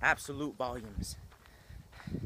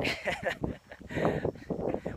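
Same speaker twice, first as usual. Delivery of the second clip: short laugh; 6 semitones higher than usual. Real, not acted. Laughs mid-sentence.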